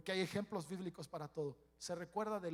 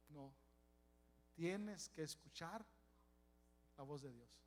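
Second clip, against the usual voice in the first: soft slow counsel; 4 semitones lower, 11 dB quieter than usual. Acted. Pastor repeats counsel softly, slowly.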